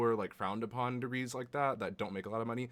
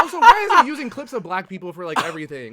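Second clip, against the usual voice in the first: indignant; 12 semitones higher, 15 dB louder, faster than usual. Real, not acted. Indignant about the clip.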